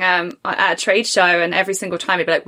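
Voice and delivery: normal talking voice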